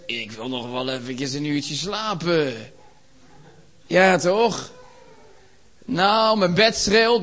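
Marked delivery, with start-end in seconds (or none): 0.1-2.7 slow, low, drawn-out wheedle; lingering, drowsy plea; sleepy body talking. Acted. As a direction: drag the words out like a body begging for sleep. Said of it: "Mijn lichaam zegt".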